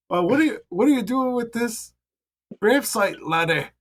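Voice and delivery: put-on high old Danish woman accent; Danish old lady Meryl. Meryl asking the grave cleaner again in a high Danish accent. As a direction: repeat the old lady's challenge, pitched high, Danish accent. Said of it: "Meryl says".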